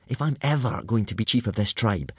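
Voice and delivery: normal talking voice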